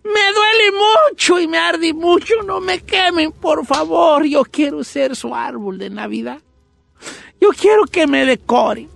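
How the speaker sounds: high, drawn-out wailing plea; cries of pain. Acted. Tree burning in the fireplace, crying in pain. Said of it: "con esos gritos de dolor".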